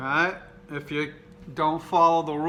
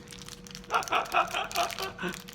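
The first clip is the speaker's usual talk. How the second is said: laughing; 3 dB quieter than usual. Real, not acted. Laughing at own joke.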